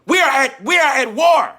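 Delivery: very high, loud shout; battle cry. Real, not acted. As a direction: cry it out at full force.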